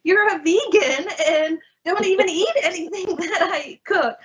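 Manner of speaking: high, fast, laughing disbelief; high incredulous retort, half laughing. Retold or imitated. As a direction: scoff at the sister's nerve. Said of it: "she was like".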